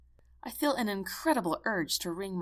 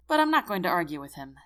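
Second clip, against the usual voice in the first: ordinary delivery, nothing marked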